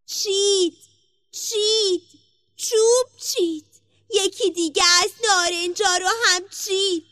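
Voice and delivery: high, loud tattling tree voice; magic tree. A narrator's reading. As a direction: call out the theft in a high character voice. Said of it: "درخت جادو به صدا در اومد". (صدای بلند و زیرِ درخت جادو)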